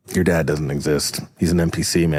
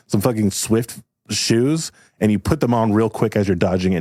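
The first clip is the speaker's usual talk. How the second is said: ordinary talk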